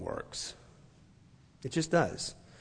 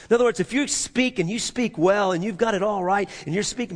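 ordinary talk

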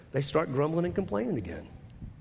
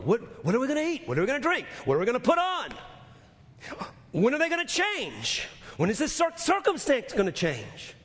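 mock grumbling; 7 semitones higher than usual.

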